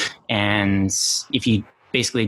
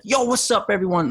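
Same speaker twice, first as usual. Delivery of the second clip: mock YouTuber scream; 9 semitones higher than usual. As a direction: imitate a fake-excited YouTuber intro, mild mock yell. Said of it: "they scream at the camera and stuff like".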